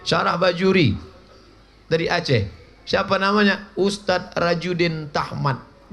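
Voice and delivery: ordinary talk, nothing marked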